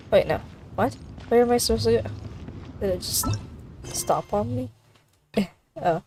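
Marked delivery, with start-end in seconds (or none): none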